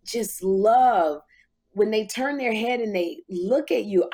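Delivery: ordinary talk, nothing marked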